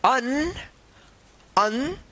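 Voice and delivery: teacher chanting vowels one by one; slow classroom vowel recital; reciting vowels. Shown as a demonstration. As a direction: call out each vowel clearly for the class. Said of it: "जैसे हम पढ़ रहे हैं".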